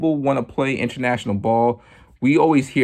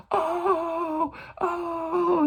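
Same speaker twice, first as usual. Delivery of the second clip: very high, soft crying oh; mock wail; 5 dB quieter than usual. DJs swooning over the triplet flow, high and wailing. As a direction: swoon in awe. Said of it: "and it's like".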